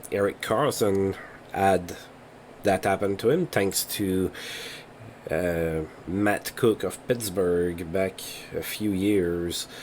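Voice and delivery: normal talking voice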